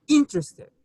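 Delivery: "Interested" with the first syllable IN punched high and loud, falling after; stress on first syllable. Shown as a demonstration. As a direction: hit IN hard in "Interested", then drop. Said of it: "The stress is on the first syllable".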